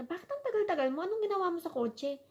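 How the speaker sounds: imitating herself scolding her husband; mock scolding. Wife retelling how she scolded Gunner for taking long, mock scolding. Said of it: "pinagalitan ko pa siya".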